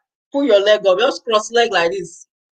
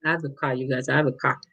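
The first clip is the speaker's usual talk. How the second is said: "I have a car" said lower and softer with call turned into car; mock accent; 8 semitones lower, 8 dB quieter than usual. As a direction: joke that his accent turns call into car. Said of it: "I have for accent, though".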